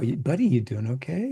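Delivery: usual speaking voice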